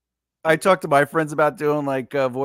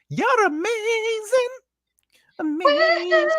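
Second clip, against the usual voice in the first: very high, stretched-out gushing squeal; squealed praise; slower than usual. Pretend. Voice actor hamming praise for the book guests, squealed high.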